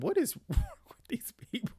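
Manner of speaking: high-pitched, laughing exasperation; laughing disbelief. Real, not acted. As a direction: break up laughing mid-question in disbelief.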